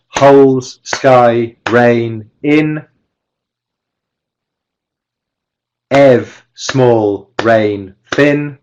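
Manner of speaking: each word a separate, evenly timed beat; isolated rhythmic words with a gap after each; clapped stressed syllables. Shown as a demonstration. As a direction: chant only the stresses, one per clap. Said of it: "then we can clap it".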